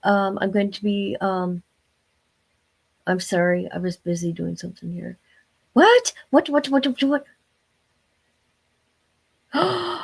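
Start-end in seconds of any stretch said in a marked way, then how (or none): 5.7-10.0 high, startled repeated what; surprised. Real, not acted. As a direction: jump in pitch with each what. Reacting to something surprising.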